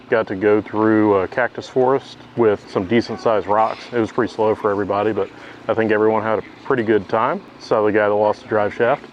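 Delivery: ordinary delivery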